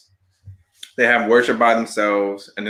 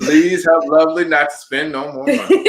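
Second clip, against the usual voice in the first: mock petty prayer; 7 semitones higher, 4 dB louder than usual. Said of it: "They be like".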